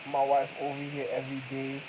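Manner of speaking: ordinary talk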